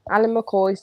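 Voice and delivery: usual speaking voice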